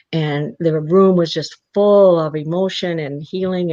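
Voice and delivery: ordinary delivery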